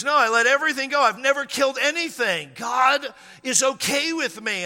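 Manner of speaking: high, loud retold protest; emphatic self-defence. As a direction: imitate him insisting at near-shout, slowing at the end. Imitates man insisting he is innocent.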